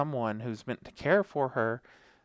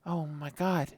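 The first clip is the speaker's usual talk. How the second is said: upset dog voice; 5 semitones higher than usual. Retold or imitated. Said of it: "she's like".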